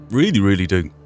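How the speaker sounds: ordinary talk, nothing marked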